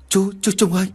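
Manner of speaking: high-pitched alarmed call; alarmed shout. A narrator's reading. Tâm calls to the collapsing Uncle Trung, alarmed shout. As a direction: cry out his name as he collapses. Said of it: "Anh Tâm hét toáng cả lên".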